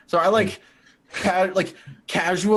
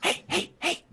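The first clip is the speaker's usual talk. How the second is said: breathy, high whispered hushing; whispered hey; 14 semitones higher, 5 dB quieter than usual. Acted. Edgar hushing Ace in a whisper. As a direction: hush her urgently.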